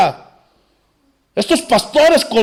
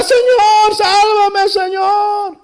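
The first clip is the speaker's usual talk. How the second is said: crying out for help; 12 semitones higher, much slower than usual. Retold or imitated. Peter sinking, crying out to Jesus, high desperate shout. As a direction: call out like a man going under the waves. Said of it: "Y comenzó a gritar".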